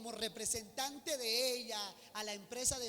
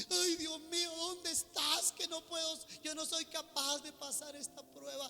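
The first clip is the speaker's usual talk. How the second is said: high, louder mock-weeping plea to God; hammed-up crying prayer; tearful lament; 6 semitones higher, 5 dB louder than usual. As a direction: wail it like someone crying at home, overwhelmed. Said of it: "estés allá llorando en tu casa".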